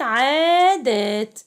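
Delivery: عادات loudly stretched, the first aa held long; syllable analysis. Shown as a demonstration. Teacher breaking words down for pupils, stretched syllables. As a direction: sound out عادات slowly and loudly. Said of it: "حلل الكلمات".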